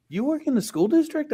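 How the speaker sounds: high-pitched mock disbelief; imitated incredulous wife. Wife doubting his job, imitated incredulity. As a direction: ask it way up high, as a shocked wife. Wife's astonished question, acted.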